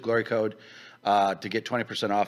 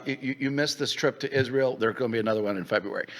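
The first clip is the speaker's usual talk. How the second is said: normal talking voice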